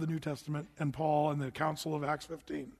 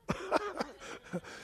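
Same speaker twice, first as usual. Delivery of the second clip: mock laugh; 12 semitones higher, 7 dB quieter than usual. Reading that Abraham laughed.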